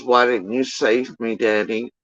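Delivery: put-on a hushed, half-hearted little-girl whine; mock little daughter. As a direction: quietly imitate the daughter asking why. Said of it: "She goes".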